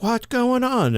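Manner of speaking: high, puzzled what's going on; startled question. Retold or imitated. Costumed storyteller suddenly spooked, asking high and startled. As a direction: act out her sudden confusion. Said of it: "And then she's like".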